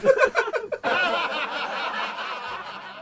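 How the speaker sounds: high jeering laugh; mocking laugh. Bad people laughing at Noah, high jeering laugh. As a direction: cackle in scorn. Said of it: "Laughed the bad people".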